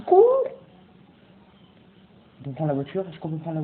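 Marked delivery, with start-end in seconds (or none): 0.0-0.4 high, loud warning, building to the end; alarmed shout. Real, not acted. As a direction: build to a yell on the last word. Zombie alert. (alerte criée, aiguë et forte)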